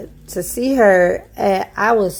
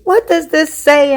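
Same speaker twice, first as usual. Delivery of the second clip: sharp raised mock of mom's demand; angry demand; 8 semitones higher than usual. Mother waking her daughter, demanding answers angrily. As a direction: fire the question high and hard.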